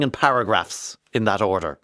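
usual speaking voice

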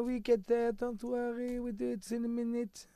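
put-on high, easy-going French accent. Impersonating the French crew in a French accent. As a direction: mimic a laid-back Frenchman in a French accent. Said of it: "What other accents can you do".

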